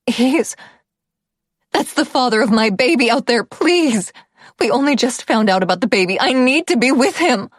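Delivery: high, loud and desperate begging; desperate pleading. A narrator's reading. Pleading about the baby.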